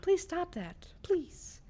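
high, whiny plea; high pleading. Real, not acted. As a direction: beg her to stop, voice up high. Begging friend to stop.

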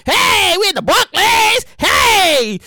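loud, slow hype-man shout, drawn out. Retold or imitated. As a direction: hype the crowd like a live show host. Mock MTV hype announcement.

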